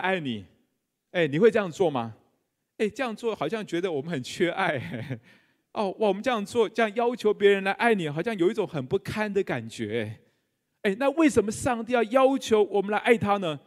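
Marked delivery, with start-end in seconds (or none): none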